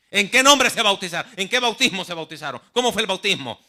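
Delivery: high, loud hammering questions; loud interrogation. Retold or imitated. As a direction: press them hard. Zealots press about baptism.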